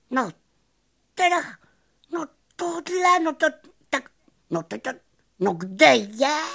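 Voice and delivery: high squeaky stammering in broken bits; flabbergasted cricket. A narrator's reading. Cricket staring after the vanished magician, high astonished stammer. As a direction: stutter in surprise, then stretch the question. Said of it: "vyjevil se Cvrček".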